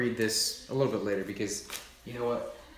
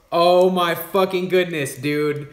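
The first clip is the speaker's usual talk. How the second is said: louder and higher, slowed down in awe; excited outburst; 5 semitones higher, 7 dB louder, slower than usual. Real, not acted. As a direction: burst out with real amazement. Finding lights in the chopsticks.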